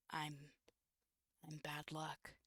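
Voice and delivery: quiet. Acted. Greenfire confessing, voice fading.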